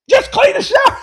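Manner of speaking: a high, loud, exasperated shout; furious grandma shout. Retold or imitated. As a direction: snap at the kid who won't play along. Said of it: "She just got so mad".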